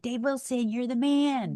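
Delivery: retold crowd cheer, not shouted; cheering fans. Fans hailing the college quarterback, cheering. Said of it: "people cheering for me".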